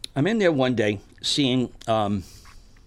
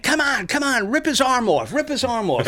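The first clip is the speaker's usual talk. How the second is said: higher, eager cheering at the screen; egging on; 9 semitones higher, 3 dB louder than usual. Retold or imitated. Heckler urging the movie monster, eager higher cheer. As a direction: urge the monster on like a fan. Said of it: "the guy's saying".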